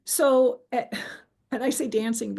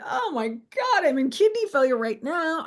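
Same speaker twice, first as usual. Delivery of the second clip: dramatic complaint; 6 semitones higher, 4 dB louder than usual. Retold or imitated. Telling Freddie about her pain.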